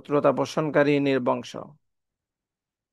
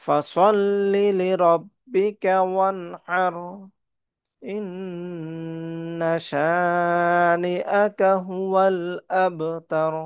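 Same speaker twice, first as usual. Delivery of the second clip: melodic chanted recitation; full tajweed recitation; Quran recitation; 4 semitones higher, much slower than usual. Shown as a demonstration. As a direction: recite the sura like a qari.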